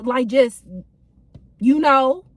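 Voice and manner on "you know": ordinary delivery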